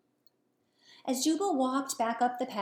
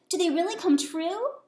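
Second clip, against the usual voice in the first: higher, louder eager bullfrog; excited; 7 semitones higher, 5 dB louder than usual. Acted. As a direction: ask it with wide-eyed excitement. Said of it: "Jubal exclaimed".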